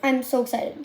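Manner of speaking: ordinary talk, nothing marked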